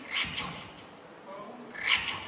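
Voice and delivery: hushed whisper of a warning voice. Retold or imitated. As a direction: breathe the word racun like a secret warning. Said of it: "ada suara bisik begini". (bisikan peringatan yang berdesis)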